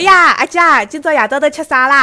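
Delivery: loud, high Shanghainese market auntie calling out; market vendor auntie. Retold or imitated. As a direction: hail a customer like a stall auntie. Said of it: "走到菜摊前".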